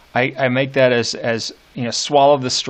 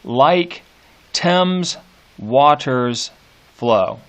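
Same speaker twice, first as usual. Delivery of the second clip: "like Thames waters flow" spaced out, "like" and "Thames" raised; word by word stress; 2 semitones higher, much slower than usual. Shown as a demonstration. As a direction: set each word apart, lifting "Thames". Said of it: "completely messed up line".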